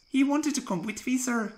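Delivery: hesitant engine. Acted. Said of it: "began Peter Sam".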